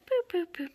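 high, loud little boops; playful booping. Real, not acted. Booping kittens with a toy, high playful noises.

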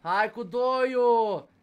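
loud, drawn-out cheer; will it on, stretching the words slowly; urging on. Real, not acted. Urging on the game, building.

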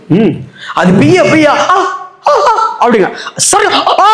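playful ow ow as the tape rips off; laughing little yelps of pain; mock cries of pain with laughter. Preacher acting out a plaster being pulled off, laughing yelps. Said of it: "இப்ப பிப்பாங்க பாரு".

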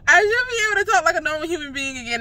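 laughing while talking fast. Real, not acted. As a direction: laugh through the words.

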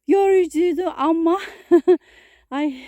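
laughing while out of breath; breathless laugh. Real, not acted. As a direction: laugh it off, panting. Laughing about tiring climb. (nefes nefese gülerek)

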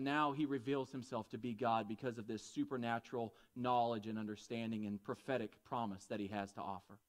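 ordinary delivery

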